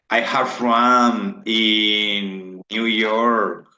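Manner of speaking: very slow, each word spaced out for learners; slow model sentence. Shown as a demonstration. Teacher modelling an English sentence slowly for students. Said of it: "teacher models sentence".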